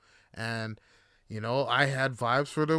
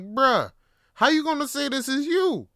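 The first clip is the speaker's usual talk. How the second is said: louder, higher indignant bruh; exasperated protest; 10 semitones higher, 5 dB louder than usual. Retold or imitated. Confronting date about photos, protests loud and high. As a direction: call her out in loud disbelief.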